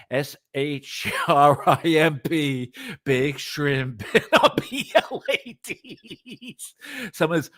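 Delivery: slow drawn-out spelling; slow teasing. Pretend. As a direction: stretch each word out teasingly.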